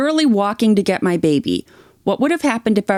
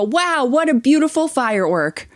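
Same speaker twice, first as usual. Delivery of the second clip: higher, hammed-up gasp of wonder; mock awe; 6 semitones higher than usual. Mocking what the teen expected everyone to say, in hammed-up awe. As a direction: play the dazzled crowd for a laugh. Mocking crowd admiring fireworks.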